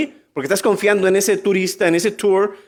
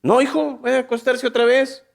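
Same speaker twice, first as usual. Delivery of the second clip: Eli answering; 6 semitones higher than usual. Acted. Old Eli answers Samuel.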